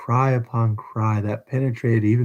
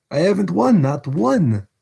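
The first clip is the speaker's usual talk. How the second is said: high, desperate insistence; frantic denial; 8 semitones higher, 3 dB louder than usual. Acted. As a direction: deny it in a panic, voice up high.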